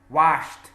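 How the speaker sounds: "Washed" loud, ending in a T, washt. Shown as a demonstration. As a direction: say "Washed" with a crisp voiceless ending. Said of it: "Pronunciation of D and T are extremely similar".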